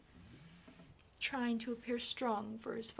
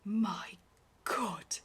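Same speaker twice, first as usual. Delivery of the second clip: breathed; 6 dB louder than usual. Acted. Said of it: "the Duke breathed".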